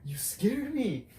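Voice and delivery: high, loud, slow scolding mom voice; imitating startled mom. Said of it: "And she was like".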